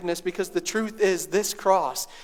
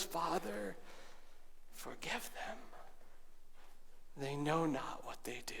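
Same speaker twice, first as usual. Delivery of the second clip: softer, falling to a whisper; hushed cry; 2 semitones lower, 16 dB quieter than usual. A narrator's reading. Preacher ending the repeated prayer in a whisper. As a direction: let the prayer fade to a breath. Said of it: "he cries out with these words".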